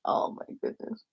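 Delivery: hushed, breath-only exclamation; retold under-the-breath dismay; mortified aside. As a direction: whisper it as if cringing. Retold mortified reaction to his answer.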